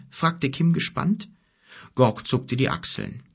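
plain reading voice

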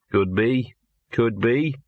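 mildly reluctant concession; grudging. Acted. Dog admits there may be a gap, grudging. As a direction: concede it grudgingly, each half left hanging. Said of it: "said the dog grudgingly".

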